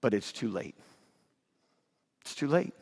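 soft, low and final; somber hush. Real, not acted. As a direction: drop the voice to a grave hush. Solemn verdict after the plea.

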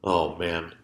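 ordinary talk

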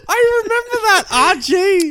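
words squeezed out through helpless laughter; cracking up. Real, not acted. Cohost hearing the quoted line, laughing hard.

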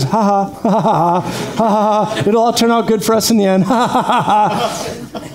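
big deliberate "ha ha" laugh through the words; forced laugh. Shown as a demonstration. Pastor showing how he and Heather laugh at problems, hearty put-on laugh. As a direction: laugh on purpose at the trouble. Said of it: "the first thing we do is".

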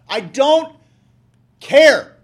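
a real full-force shout on "care". Real, not acted. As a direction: slam down the word care.

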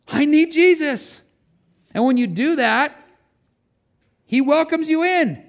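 high-pitched fervent confession from the pulpit; impassioned preaching. Real, not acted. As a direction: draw out the key words, pitched high. Preacher voices the sinner's confession.

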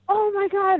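high excited gasp. Retold or imitated. Retold excited reaction.

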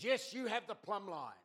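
man in cafe insisting earnestly; insistent prophet. Retold or imitated. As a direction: proclaim it like a zealous prophet. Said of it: "He says".

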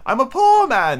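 high, pleading little man's voice; nervous Hatter. Acted. Hatter pleading before the king, high and nervous. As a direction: plead anxiously as the frightened Hatter.

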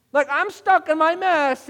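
loud, high, slow complaint; exasperated. Acted. Struggling listener answering a pastor's miracle story, exasperated. Frustrated listener voiced in reply.

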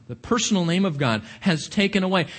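usual speaking voice

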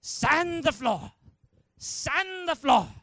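high, loud, slow drill command; hammed-up karate master shouting instructions; Mister Miyagi. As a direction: belt out the order with drawn-out words.

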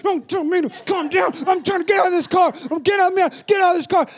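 high, loud, frantic mom; panicked screaming. Retold or imitated. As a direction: imitate a trapped mother yelling back. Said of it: "she's at this point screaming".

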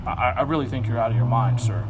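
lightly voiced calm gentleman, low and unhurried; a hint of a polite aristocrat, said low; mock debonair. Preacher mocks a calm Festus, low and genteel. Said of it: "all debonair".

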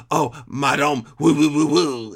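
hammed-up French accent, tossed off; a quick jokey French accent imitation; lightly mocking French accent. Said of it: "It's the accents".

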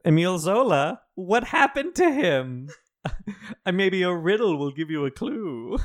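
high, loud mock villain taunting with a laugh; the Riddler teasing a grieving house with clues. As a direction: play a gleeful comic-book Riddler. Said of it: "the Riddler shows up, and he's like".

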